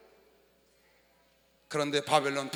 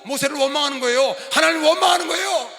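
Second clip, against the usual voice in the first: raised pulpit voice, climbing to a shout; forceful preaching; 6 semitones higher, 11 dB louder than usual. Acted. Pastor telling of Israel blaming Moses, forceful preaching. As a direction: build in indignation with each phrase.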